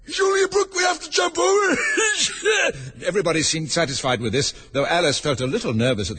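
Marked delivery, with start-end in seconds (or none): 0.0-1.8 high, loud, hoarse horse voice; loud, strained horsey cry. Acted. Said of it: "drew it in and said". 1.8-3.0 loud, high whinnying splutter; whinny hoarsely after the line; high choking horse noise. Acted. Horse character splutters after speaking.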